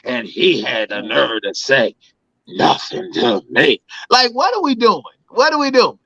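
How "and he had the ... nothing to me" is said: much lower put-on voice, slowed at the end, mocking outrage; pearl-clutching imitation. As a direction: ham up the scandalized woman. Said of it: "clutch her pearls".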